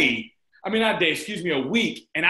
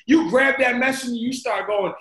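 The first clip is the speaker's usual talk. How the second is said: ordinary delivery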